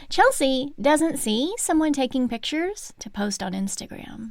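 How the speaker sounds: ordinary talk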